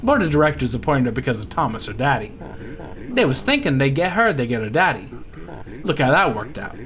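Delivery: dish it like a bayou insider; folksy Louisiana pirate. Acted. Lucky explaining Laura to the party, folksy Louisiana voice.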